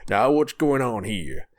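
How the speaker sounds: slow Texas drawl, big fat sheriff; slow Texas sheriff with authority; Texas sheriff accent. Acted. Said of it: "why he's got a Texas accent".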